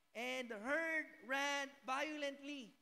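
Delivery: loud, slow, heavy proclamation; forceful reading. Acted. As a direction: thunder the verse out slowly. Preaching the verse loudly. (malakas at mabagal na pagbasa)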